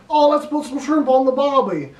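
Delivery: hammed-up Australian accent, like a radio ad; over-the-top fake Australian accent; terrible Australian accent. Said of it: "that's a terrible Australian accent".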